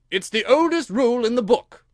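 high, loud royal bluster; insistent retort. Acted. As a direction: insist on it to win the quarrel. King argues back at Alice.

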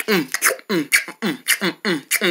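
low, soft pretend chewing hums; munch in short muffled hums; munching noises. Eating a banana for energy, munching noises.

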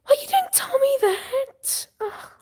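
high, slow fake sob; mock crying. Streamer to Jordan about school news, mock crying wail. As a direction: wail it like a hammed-up cry. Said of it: "I'm gonna cry for this".

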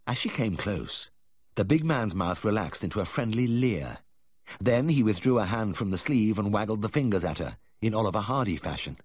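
ordinary delivery

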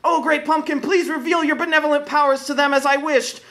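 put-on a child begging his idol; eager young boy. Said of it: "Linus calmly turned to the huge fiery pumpkin".

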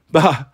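loud scornful bah; dismissive bah. Acted. Goddess dismisses Hazel.

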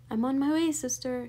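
shout. A narrator's reading. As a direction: call out loudly to someone in another room. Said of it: "shouted Baby".

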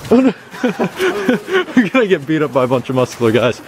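said through laughter; laughing. Real, not acted. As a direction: giggle through the line. Laughing while repeating.

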